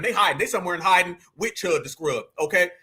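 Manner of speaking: ordinary talk, nothing marked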